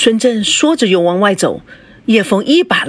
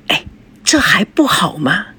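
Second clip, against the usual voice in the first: hushed whisper. Acted. Covers his mouth, hushing him.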